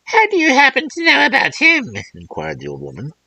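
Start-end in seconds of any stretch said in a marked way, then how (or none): 0.0-1.9 put-on old crone voice. Old woman voiced in fairy tale.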